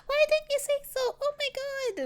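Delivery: put-on very high, excited childlike squeal; a thrilled young girl; delighted little girl. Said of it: "she's like gasps".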